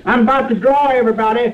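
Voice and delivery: broadcast it to the whole street. Acted. Cicero announces draw to the crowd.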